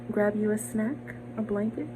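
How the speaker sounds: ordinary delivery, nothing marked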